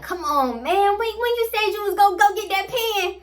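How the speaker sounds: high, pleading begging voice; whiny high-pitched pleading; desperate fiend Pookie. Retold or imitated. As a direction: beg like a desperate addict for the pen. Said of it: "I really felt like Pookie".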